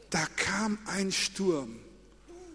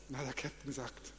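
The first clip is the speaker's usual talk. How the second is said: ordinary delivery, nothing marked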